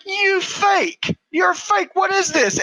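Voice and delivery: high-pitched mock outrage; indignant high reenactment; outraged retold accusation. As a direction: call out a faker, voice shooting up. Retells outraged discovery of fake.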